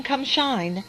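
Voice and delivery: usual speaking voice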